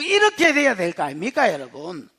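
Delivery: normal talking voice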